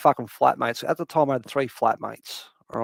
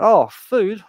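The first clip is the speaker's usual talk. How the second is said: higher, louder perked-up cat voice; pleasantly surprised; 6 semitones higher, 8 dB louder than usual. Acted. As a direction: act out a cat suddenly noticing food. Voices cat spotting food.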